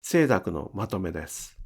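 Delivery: ordinary talk, nothing marked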